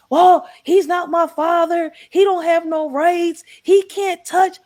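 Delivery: high, loud defiant protest; angry teen yell. Retold or imitated. Son retold yelling at mother.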